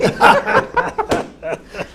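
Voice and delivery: breathless high-pitched laugh; high airy laugh. Real, not acted. Laugh after the punchline. (carcajada aguda y sin voz)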